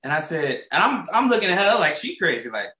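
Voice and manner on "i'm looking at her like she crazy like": usual speaking voice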